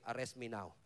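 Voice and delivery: deep and soft, calmly daring them; low quiet Jesus voice. Acted. Preacher voicing Jesus to fallen soldiers, low and quiet. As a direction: say it low, unhurried, in full control.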